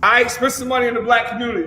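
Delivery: ordinary talk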